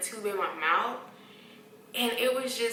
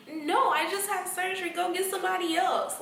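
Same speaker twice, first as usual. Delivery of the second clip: high, louder protest; upset refusal; 9 semitones higher, 5 dB louder than usual. Retold or imitated. Just after surgery, refusing the nurses, high and indignant. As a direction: push back, high and indignant. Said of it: "I'm like".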